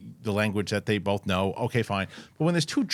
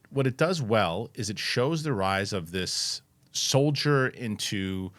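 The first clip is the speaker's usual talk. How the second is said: ordinary delivery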